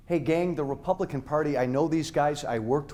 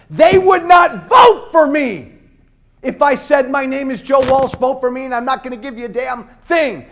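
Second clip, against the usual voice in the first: much higher, much louder rally cry; impassioned shouting at the crowd; fiery shout; 8 semitones higher, 10 dB louder than usual. Real, not acted. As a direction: preach it at full force to the crowd. Fiery campaign speech peak.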